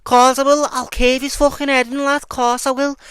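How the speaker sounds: hammed-up cocky lad, high and loud; Paddy Pimblett. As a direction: play a brash Liverpool lad eager to fight. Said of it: "and then he's just like".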